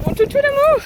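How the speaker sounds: high, loud pet voice; talking to a dog. Real, not acted. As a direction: squeal with love for the dog. Introducing her dog.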